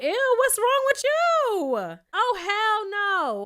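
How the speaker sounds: very high, slow, loud squeal; a viewer horrified by the king, high and slow; mock disgust. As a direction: drag out a horrified ew. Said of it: "I was like".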